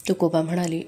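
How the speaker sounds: plain reading voice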